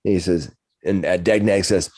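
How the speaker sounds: usual speaking voice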